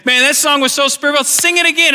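high, loud, hammed-up worship hype; mock enthusiasm. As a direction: call out to the choir in exaggerated excitement. Acts himself hyping up the congregation.

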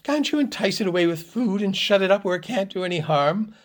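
high-pitched frantic plea; panicky scream. A narrator's reading. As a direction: beg for help in rising alarm. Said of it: "screamed Mavis".

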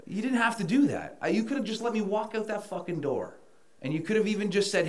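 heated rant. Real, not acted. Ranting to the host about the company's empty promise, heated and high. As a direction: let the frustration boil over.

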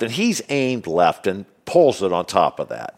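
ordinary talk, nothing marked

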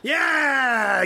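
long, high triumphant yell; victory shout. Real, not acted. Quiz winner crowing at his co-host, triumphant shout. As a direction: let out a stretched victory cry.

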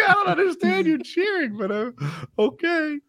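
high, laughing bemused voice; laughing mock reply. Answering the cheering fans, high and laughing. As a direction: play it baffled through laughter. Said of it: "I'm like".

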